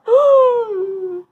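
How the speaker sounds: high, long pretend sob; mock crying wail. Bully made to cry, hammed-up wail. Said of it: "now he's crying".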